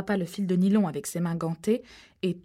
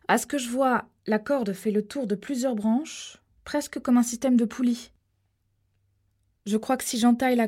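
a hint of a tense man up a tree, a bit higher and louder; lightly raised voice, thinking aloud on a branch; lightly voiced Harold; 4 semitones higher, 4 dB louder than usual. (Harold perché dans l'arbre, à peine joué, voix un peu tendue)

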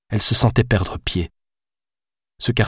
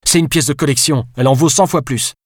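indignant outburst, higher and sharper; narrated furious retort, raised pitch; exploding; 6 semitones higher, 4 dB louder than usual. Acted. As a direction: blurt it out in outrage. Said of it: "explosa Alice".

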